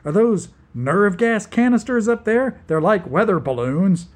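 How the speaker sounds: panicked. Acted. Angelo panicking in free fall.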